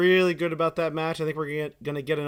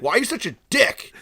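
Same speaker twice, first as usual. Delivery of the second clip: imitated accusation; 6 semitones higher, 4 dB louder than usual. Retelling the clash with customer.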